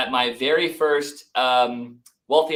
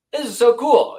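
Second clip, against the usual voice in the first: higher and louder, bursting with delight; excited exclamation; 10 semitones higher, 8 dB louder than usual. Retold or imitated. To the podcast host, recalling the payoff, excitedly. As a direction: gush about how cool it is. Excited about Airbnb income.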